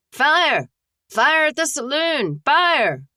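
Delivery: high, loud cry of warning; alarm shout. Acted. Townsman shouting a fire warning down the street, loud alarm. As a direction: yell it out to wake the town.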